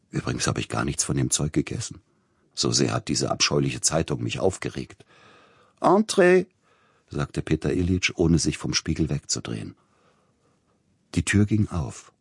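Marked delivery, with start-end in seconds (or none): 5.8-6.5 high, ringing call through the door. A narrator's reading. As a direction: sing out a loud, high answer to a knock. Answers a knock at the door.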